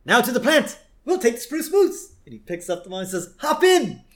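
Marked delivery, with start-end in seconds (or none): 0.1-2.1 high, loud grand announcement; mock grand Burns. Mr. Burns announces his plane to Smithers, high and loud. 3.4-4.0 high, loud grand announcement; mock grand Burns. Mr. Burns announces his plane to Smithers, high and loud.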